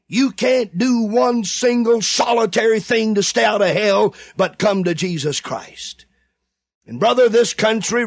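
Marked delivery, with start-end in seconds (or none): none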